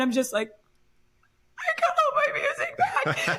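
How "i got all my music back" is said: very high, drawn-out mock sob; re-enacted tearful wail. Telling Travis the news, mock crying. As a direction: squeal it slowly as if breaking down. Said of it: "absolutely heaving, crying".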